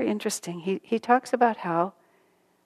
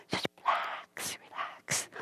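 soft unvoiced whisper; whispered slowly; whispered relax; 14 dB quieter, slower than usual. Shown as a demonstration. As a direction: breathe it out to calm them. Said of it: "soothing advice".

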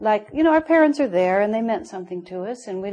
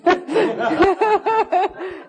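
high, loud burst of laughter; real laugh; 12 semitones higher, 6 dB louder than usual. Real, not acted. Laughing at her husband calling her too emotional, high loud laugh. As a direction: let a big laugh break out.

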